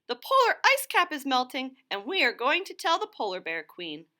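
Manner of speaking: two eager birds with urgent news; lightly voiced chorus of birds; birds answering. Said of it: "replied Loony Little and Dovekie Lovekie".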